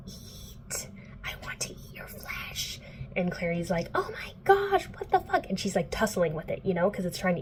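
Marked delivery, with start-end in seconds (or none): 0.1-2.8 very soft, voiceless creature whisper; eerie, drawn-out monster whisper; whispering demon. Retold or imitated. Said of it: "it's, like, whispering". 3.9-5.5 high-pitched, squealed shock; hammed-up horrified exclamation; mock alarm. Retold reaction to attacking demon.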